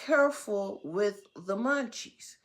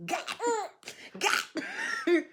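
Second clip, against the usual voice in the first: mock dismay; 11 semitones higher than usual. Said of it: "looked at myself like".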